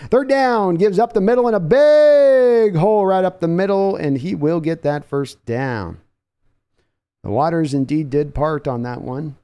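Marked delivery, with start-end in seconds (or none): 0.1-4.0 rising excited call, higher and louder; sudden jump to an excited call; excited play call. Real, not acted. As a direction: call the big gain as it breaks. Big run called live.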